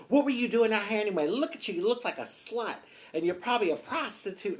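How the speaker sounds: high-pitched, accusing interrogation; aggressive officer. Retold or imitated. Bulldog officer berating the driver who stopped, aggressive. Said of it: "being real aggressive with me".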